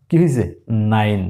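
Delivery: ordinary talk, nothing marked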